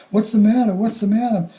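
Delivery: high, loud frantic question; frantic plea. Retold or imitated. Mother pleading with the paramedic, frantic. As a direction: beg the paramedic for answers. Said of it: "she said to the paramedic".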